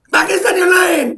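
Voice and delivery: ranting, shouting the demand again; angry shout. Real, not acted. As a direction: yell it harder the second time. Repeated shout.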